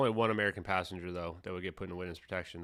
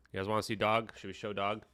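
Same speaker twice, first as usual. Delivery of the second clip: usual speaking voice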